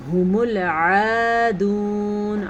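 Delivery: ھم العادون chanted slowly, clear ain; slow recitation. Shown as a demonstration. Teacher modeling the ain in العادون. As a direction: recite with a careful throaty ain. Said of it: "عین کے تلفظ کا دھیان رکھنا ہے".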